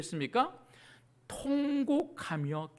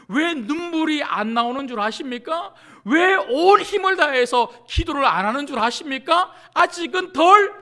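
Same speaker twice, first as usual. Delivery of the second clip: loud, raised, building challenge; fervent preacher building to a shout; impassioned preaching; 5 semitones higher, 11 dB louder than usual. Real, not acted. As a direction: hammer the congregation with urgent questions. Impassioned preaching, escalating questions.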